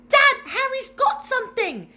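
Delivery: put-on loud, high, urgent child's call; excited calling. A narrator's reading. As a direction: shout to Dad to get his attention. Dudley tattles to his father.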